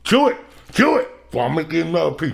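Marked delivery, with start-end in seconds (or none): 0.0-1.1 high, loud chant to chew; egging him on, voice up; pushy high-pitched cheer; loud urging. Real, not acted. Urging friend to chew the gummy.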